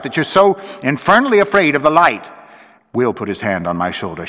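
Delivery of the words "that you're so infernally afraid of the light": raised, higher-pitched outburst; bursting out. A narrator's reading. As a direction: blurt out the challenge. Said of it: "I burst out".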